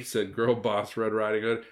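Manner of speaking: ordinary delivery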